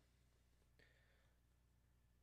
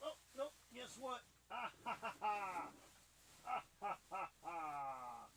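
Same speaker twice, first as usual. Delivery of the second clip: laughing; 6 semitones higher, 10 dB quieter than usual. Real, not acted. Found the polyfill, giggling.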